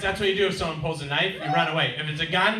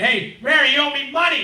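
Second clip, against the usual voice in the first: high, loud demand; imitated yelling; 10 semitones higher, 8 dB louder than usual. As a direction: mimic an angry man yelling at a building. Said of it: "and he's yelling".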